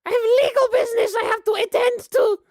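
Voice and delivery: very high, loud granny complaining; high-pitched cantankerous grandma voice; cranky old grandma. Acted. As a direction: lay down the law as a tired, tetchy old woman. Game master plays cranky grandma.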